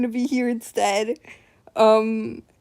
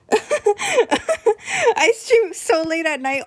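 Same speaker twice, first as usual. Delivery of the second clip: laughing; 10 semitones higher, 4 dB louder, much slower than usual. Real, not acted. Embarrassed laugh at streaming late.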